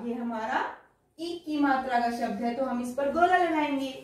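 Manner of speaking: ordinary talk, nothing marked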